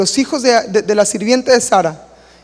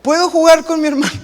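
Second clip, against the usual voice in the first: put-on higher, sweet little boy asking; innocent child; 7 semitones higher than usual. Young pastor asks mom to play with the baby, feigned innocence.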